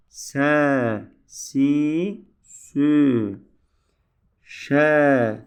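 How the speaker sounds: loud, spaced syllable drill; reciting syllables. Shown as a demonstration. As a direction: call out each sound for the class to copy. Teacher drills letter sounds.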